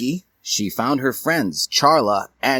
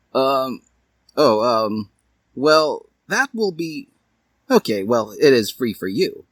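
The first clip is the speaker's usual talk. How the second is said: halting, stumbling and pitched up; flustered; 4 semitones higher than usual. Acted. Hiei flustered after touching Dally's hand, stammering. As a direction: trip over the words, embarrassed. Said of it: "He was flustered".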